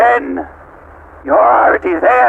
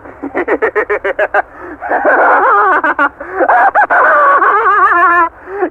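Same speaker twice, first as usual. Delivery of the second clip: long high mocking cackle; evil cackle; 12 semitones higher than usual. Machine god gloating at its captives, cackling. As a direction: cackle with cold triumph.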